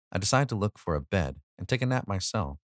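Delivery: ordinary delivery, nothing marked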